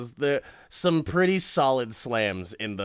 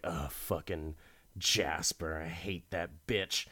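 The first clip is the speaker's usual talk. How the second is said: sneering wife; 4 semitones lower, 8 dB quieter than usual. Retold or imitated. Wife sneering about Jasper.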